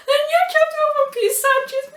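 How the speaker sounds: high, loud, sobbing words; crying while explaining. Retold or imitated. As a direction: blurt it out through tears. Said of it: "I was crying".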